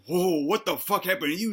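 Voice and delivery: taken-aback retold king; mock shock. Darius seeing his maimed buddy, mock shock. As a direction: play up the startled reaction a little.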